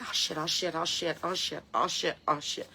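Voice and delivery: mock worry in a soft, even chant; plodding turtle. Turtle regrets crossing the road, softly imitated worry. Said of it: "now they're like".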